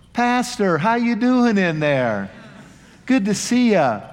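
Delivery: light up on recognizing the pastor; friendly surprised greeting. Retold or imitated. Officer recognizes the pastor at the roadside, cheerfully surprised.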